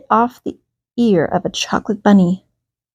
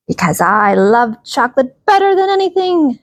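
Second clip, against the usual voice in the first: shouted; 5 semitones higher than usual. A narrator's reading. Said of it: "shouted Missus Ferdman".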